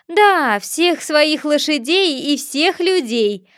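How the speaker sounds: haughty storybook braggart; pompous Humpty Dumpty. A narrator's reading. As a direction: puff yourself up, grand and condescending.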